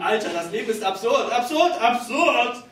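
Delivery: yelling. Pretend. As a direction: bellow it at a stranger in the street. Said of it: "ihm einfach entgegenbrüllen".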